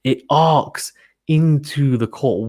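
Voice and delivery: ordinary delivery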